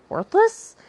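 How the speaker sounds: high and loud, dripping with scorn; scornful jab. Real, not acted. Sneering at Seth's pointless apology, high and loud. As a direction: spit the word out as a put-down. Mocking Seth's empty acknowledgement.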